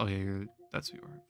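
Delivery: normal talking voice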